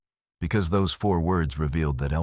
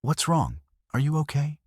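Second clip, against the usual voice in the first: worried father; 9 semitones higher than usual. Acted.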